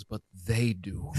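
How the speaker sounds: slow, mock Russian woman's accent; imitated Russian accent, ominously slow. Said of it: "You can do the accent".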